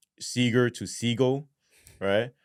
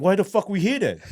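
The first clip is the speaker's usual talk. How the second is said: high, loud disbelief; incredulous, raised voice; retold alarmed protest; 10 semitones higher, 5 dB louder than usual. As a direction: demand to know why you're here. Alarmed on learning the suspect.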